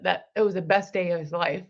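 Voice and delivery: ordinary talk, nothing marked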